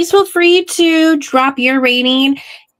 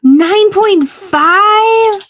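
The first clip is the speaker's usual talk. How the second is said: surprised; 9 semitones higher, 3 dB louder, much slower than usual. Real, not acted. Stunned repeat of a rating.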